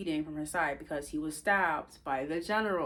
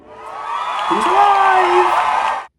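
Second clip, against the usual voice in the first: joyful shout; 14 semitones higher, 19 dB louder, much slower than usual. Pretend. Rejoicing Rowan survived.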